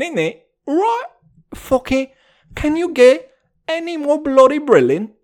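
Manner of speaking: high, hammed-up British accent. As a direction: lay on the mock British thick. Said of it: "Dude, I'm so British".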